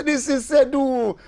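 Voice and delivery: high and slow, each word firm; slow insisting. Retold or imitated. [name] insists to the lady, slow and high. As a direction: spell it out stubbornly. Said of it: "I say".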